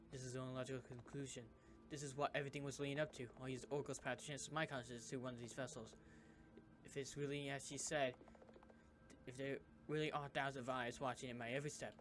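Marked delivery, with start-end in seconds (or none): 0.1-9.6 fast and quieter, racing thoughts; hurried quiet resolve; rushed inner monologue. Acted. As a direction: talk it through quickly to yourself.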